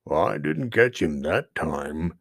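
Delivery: a hint of a low, slow bear growl; low, slow bear voice, lightly done. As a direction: mutter like a pleased bear. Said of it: "he growled".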